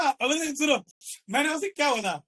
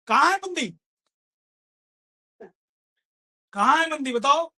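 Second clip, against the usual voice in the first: louder, higher demand for an answer; challenging shout; 3 semitones higher, 4 dB louder than usual. Real, not acted. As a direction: throw the question at them. Challenging the crowd loudly.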